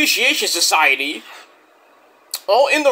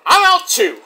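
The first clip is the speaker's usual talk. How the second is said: high, louder "I'm out too"; quitting; 13 semitones higher, 5 dB louder than usual. Retold or imitated. Jake Hager quitting the group, high and louder. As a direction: toss it off and walk away. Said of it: "he's like".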